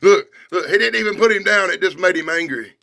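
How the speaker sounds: ordinary delivery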